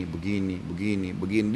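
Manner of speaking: low, soft placeholder repetitions; skimming. Retold or imitated. Preacher skips through a retold account, low and soft. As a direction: drop down and murmur the repeats.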